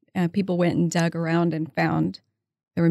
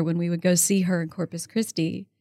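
ordinary delivery

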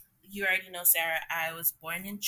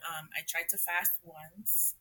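normal talking voice

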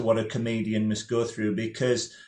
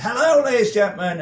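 much higher, louder, fast bouncy hello; over-eager comedian greeting; 10 semitones higher, 7 dB louder, faster than usual. Retold or imitated. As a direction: burst on stage like a keen host. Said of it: "He was so enthusiastic. And he went".

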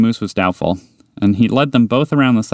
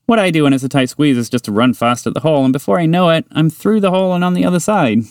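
higher, eager little mouse voice; higher mouse, keen and friendly; 7 semitones higher than usual. Acted. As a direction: tempt a new friend with chocolate. Mouse character explaining.

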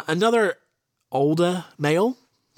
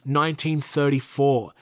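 ordinary talk

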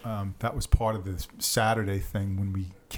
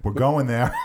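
laughing; 3 semitones higher, 7 dB louder than usual. Real, not acted. Group laughs at tailgate story.

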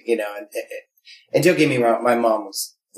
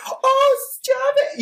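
put-on coy girlfriend; much slower than usual. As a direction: squeal it like a girl being teased. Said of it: "And she's like".

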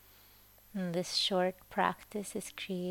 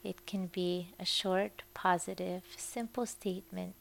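guided meditation. Acted. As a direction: ease the listener gently toward sleep. Leads a Yoga Nidra meditation.

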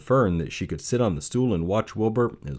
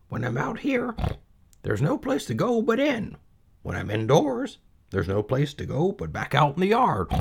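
high, bored piglet voice; high-pitched fed-up piglet; Wilbur the pig; 9 semitones higher than usual. Acted. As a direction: whine it like a restless young pig. Wilbur complaining about the yard.

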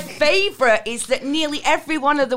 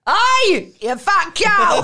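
higher, louder cheeky yell from a window; mock kid's shout; 6 semitones higher, 5 dB louder, much slower than usual. Eight-year-old yells insult from mum's window, cheeky shout. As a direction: holler it like a cheeky kid.

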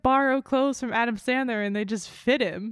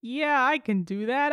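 usual speaking voice

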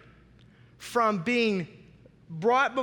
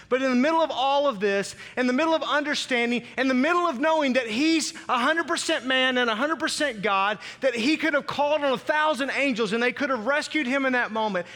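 rising preaching; 5 semitones higher, 4 dB louder than usual. Acted. Building preaching intensity.

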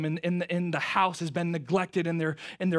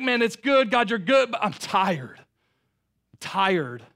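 starts louder in praise, then sinks into tiredness; praise, then let the tiredness come through; praise fading to weariness; 5 semitones higher, 7 dB louder, slower than usual. Retold or imitated. Worn-out youth pastor praying to God, praise fading to weary.

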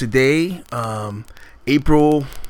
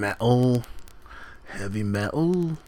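lightly put-on Liverpool accent, softer; a half-hearted Scouse rocker explaining heavy metal in a Liverpool accent; 2 semitones lower, 10 dB quieter, slower than usual. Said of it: "A Liverpool accent".

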